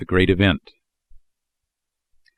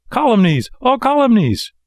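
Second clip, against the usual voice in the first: indignant puppet protest; 13 semitones higher, 3 dB louder than usual. A narrator's reading. Puppet defends himself.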